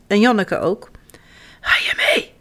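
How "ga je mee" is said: voiceless whisper. A narrator's reading. Hoarse Jip inviting Janneke along, whispering. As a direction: whisper as if the voice is gone. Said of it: "fluistert Jip".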